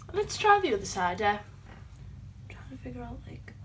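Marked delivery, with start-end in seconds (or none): 1.4-3.5 slow whispered muttering; whispering. Real, not acted. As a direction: whisper it to yourself. Muttering while struggling.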